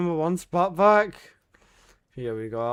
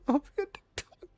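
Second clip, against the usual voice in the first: much higher, fast, squeaky mock voice through a laugh; high mocking chat voice; 10 semitones higher, 5 dB quieter, faster than usual. Shown as a demonstration. Streamer mocking the chat message, high and fast with a laugh.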